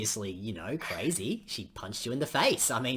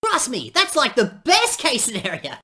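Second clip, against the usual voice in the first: overexcited; 12 semitones higher, 10 dB louder than usual. Acted. Cheerful bad advice to friend.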